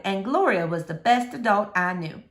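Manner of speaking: ordinary delivery, nothing marked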